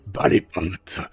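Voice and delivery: slow ominous raspy drawl; low, slow and menacing, deformed rasp; raspy creature voice. Acted. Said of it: "une voix rauque déformée par la créature".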